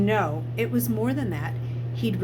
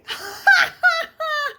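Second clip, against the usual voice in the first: high, loud screaming maniac laugh; manic laughter; 7 dB louder than usual. Acted. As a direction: shriek with wild laughter. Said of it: "screamed with laughter manically".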